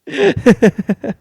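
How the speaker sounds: high, quick giggle; laugh. Real, not acted. Comic after his own shocking punchline, high giggle. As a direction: giggle at your own line.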